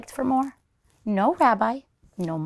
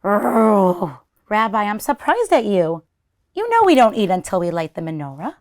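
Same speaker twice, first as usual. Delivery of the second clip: loud, quick playful telling-off; mock scolding; 5 dB louder than usual. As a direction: wag a finger at the greedy guest. Grandmother gently scolding bear.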